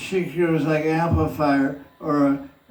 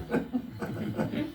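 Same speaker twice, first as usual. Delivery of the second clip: high airy burst of laughter; breathy laugh; 11 semitones higher, 11 dB quieter than usual. Real, not acted. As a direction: laugh softly at your own joke. Amused at own answer.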